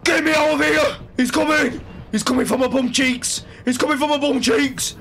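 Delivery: panicked screaming. Real, not acted. Fleeing the monster in the game, panicked screaming. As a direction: shriek it while running for your life.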